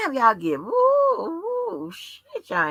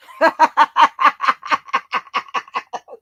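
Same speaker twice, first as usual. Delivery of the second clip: long, loud burst of real laughter; 6 semitones higher, 7 dB louder than usual. Real, not acted. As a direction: let a big laugh roll out. Laughing at a heated chat.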